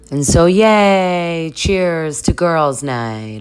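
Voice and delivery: drawn-out, high toast cheer; mock cheer. Toasting the girls on a night out, mock cheer.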